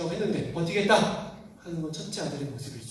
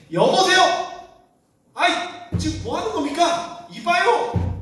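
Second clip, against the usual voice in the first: high, loud, exasperated yelling at his father; angry shout; 12 semitones higher, 6 dB louder, slower than usual. Acted. Elder son shouting at his father in anger, a loud furious outburst. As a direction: snap at Dad like a furious son, much higher and louder. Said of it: "큰아들이 막 화낸 거예요".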